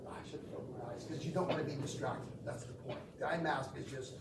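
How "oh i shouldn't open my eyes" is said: unvoiced whispered thought, much softer; whispered self-talk. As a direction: whisper it as a mock scolding to yourself. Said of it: "I'm like".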